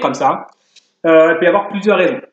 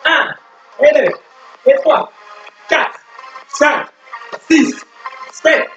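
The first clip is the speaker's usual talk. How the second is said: barking gym coach; 7 semitones higher, slower than usual. Acted. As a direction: pump the class up like a fitness coach. Leads workout over music.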